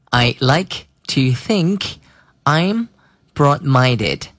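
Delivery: slow, careful sentence read; slow classroom read; slow model read. Shown as a demonstration. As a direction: model the phrase slowly. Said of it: "可以说成".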